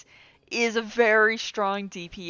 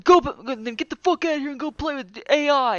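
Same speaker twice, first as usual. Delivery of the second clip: imitating the jerk; 4 semitones higher, 4 dB louder, faster than usual.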